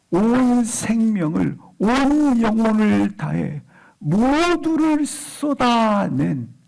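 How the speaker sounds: high, slow, stretched-out proclamation; fervent preaching. Real, not acted. Preacher telling how Hannah poured out her soul, fervent preaching. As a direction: draw out the key words with preacher's fervour.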